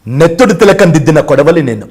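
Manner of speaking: loud heroic film declamation; Sai Kumar. Retold or imitated. Dubbed film hero declaring himself a sickle, loud declamation. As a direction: proclaim it forcefully, like a dubbed action hero. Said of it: "Sai Kumar గారి voice common కదా".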